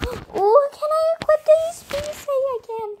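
high, rushed squeal; breath in, then high excited gabble; excited hope. Real, not acted. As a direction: ask in a fast, eager squeak. Excited hope to equip item.

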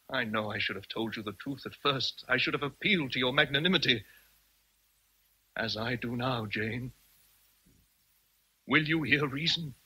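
ordinary talk, nothing marked

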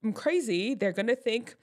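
high, mock-panicked worrying; hammed-up anxious inner voice; acted stage fright. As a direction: play a nervous performer fretting before the show. Acts out nervous performer's panicked thoughts.

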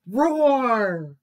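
loud, drawn-out falling roar; story-time tiger roar; little tiger roar. A narrator's reading. Tiger roars at boar.

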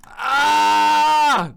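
loud high scream of frustration; frustrated scream. Real, not acted. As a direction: let out a raw angry scream. Frustrated scream at failed click. (sfrustrowany wrzask)

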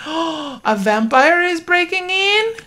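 shocked question. Real, not acted. Gasp at game event.

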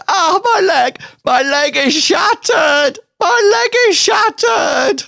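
high, loud wailing over a shattered leg; hammed-up injured bystander; fake injured cry. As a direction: ham up the agony. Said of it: "like, going".

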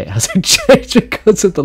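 words lost in high laughter; helpless squealing laugh overtakes the sentence; cracking up. Real, not acted. Cracks up at the ikea story.

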